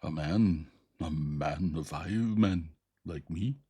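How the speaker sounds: loud, slow eager creature voice; excited ape man. A narrator's reading. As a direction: insist proudly like a beast-man. Ape man announcing the newcomer.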